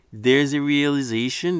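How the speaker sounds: ordinary talk, nothing marked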